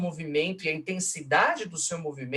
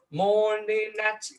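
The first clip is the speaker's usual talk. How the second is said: high, slow "morning at"; dactyl stress; 7 semitones higher, much slower than usual. Shown as a demonstration. Lecturer showing a dactyl, high drawn-out "morning at". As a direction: intone "morning at" strong-weak-weak. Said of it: "é um, é um dátilo".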